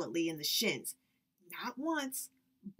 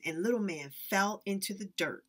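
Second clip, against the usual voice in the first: plain reading voice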